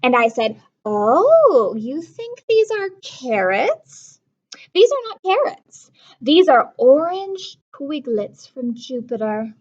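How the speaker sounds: put-on lively read-aloud to children; storybook reading. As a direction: read it like a picture book to kids. Teacher reads a picture book.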